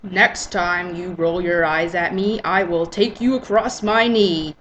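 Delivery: menacing hushed warning. Acted.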